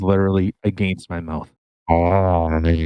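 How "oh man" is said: low, drawn-out moan into the mic; mock groan. Host with microphone against mouth, low mock groan. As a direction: drop low and stretch it out.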